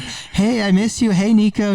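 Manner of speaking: put-on high kids calling out; excited classmates. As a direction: call out like eager little classmates. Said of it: "you hear the other kids like".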